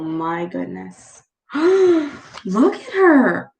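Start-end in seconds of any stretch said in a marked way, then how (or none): 1.4-3.5 gasping in surprise. Real, not acted. Gasping at the picture.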